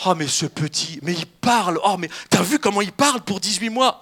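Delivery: loud, gasping mock wonder; amazed parent. Parents marvelling at their eighteen-month-old, mock amazement. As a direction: marvel aloud, hammed up. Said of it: "on était émerveillés devant nos enfants".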